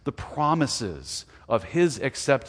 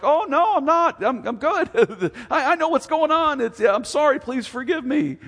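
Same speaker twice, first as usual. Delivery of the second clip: high, loud mock-flustered denial turning to apology; flustered dad; 11 semitones higher, 6 dB louder than usual. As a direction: play a caught-out dad, hammed up with a laugh. Frustrated dad caught out, acted.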